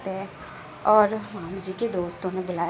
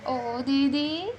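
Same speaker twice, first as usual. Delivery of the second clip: drawn-out teasing; 6 semitones higher, much slower than usual. Acted. Wing teasing sister again.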